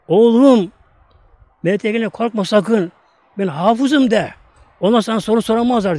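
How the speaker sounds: high, loud call over the grave; mother calling. Retold or imitated. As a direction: call down to her son, urgent and fierce. Mother calls to buried son.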